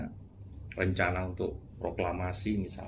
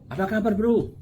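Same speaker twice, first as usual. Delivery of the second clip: cheery greeting; 12 semitones higher, 7 dB louder than usual. Retold or imitated. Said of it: "kalau ditanya".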